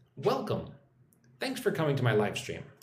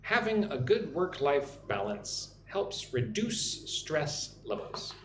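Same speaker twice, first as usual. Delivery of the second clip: slow, careful reading for students to repeat; slow model sentence; slower than usual. Shown as a demonstration. Teacher models the sentence for the live stream, slow and careful. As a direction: spell it out slowly so they can copy. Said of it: "listen and repeat after me".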